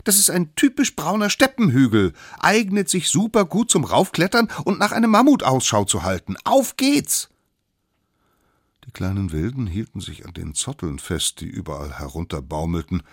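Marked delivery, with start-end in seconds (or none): 0.0-7.3 put-on high, loud, eager child figure; cocky little expert. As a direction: proclaim it and rally the troop. Youngest figure explains, rallies others. (kleiner Besserwisser, hoch und laut)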